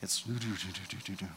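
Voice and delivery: low, soft mumbling drone; softly droning nonsense murmur; mock murmur drone. Shown as a demonstration. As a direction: imitate murmuring behind the scenes. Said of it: "It's, it's what we do".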